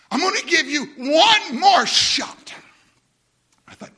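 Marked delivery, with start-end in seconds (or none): 0.1-2.5 laughing shout. Retold or imitated. Laughing loud offer to company.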